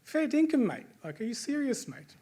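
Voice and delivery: "Fair dinkum" thrown up high in disbelief, as a question; incredulous Aussie "are you serious, mate?". Shown as a demonstration. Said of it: "you could use it as a ter-, questioning".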